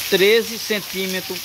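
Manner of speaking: usual speaking voice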